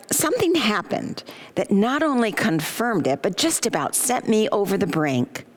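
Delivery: normal talking voice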